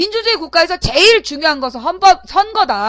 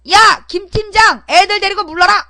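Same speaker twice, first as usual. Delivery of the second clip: higher, louder command, slowed at the start; mock yelled order; 5 semitones higher, 4 dB louder than usual. Host mocking an order to the team leader, loud mock yell. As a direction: shout the order down the line. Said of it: "그래서 막 쌍욕을 했어요".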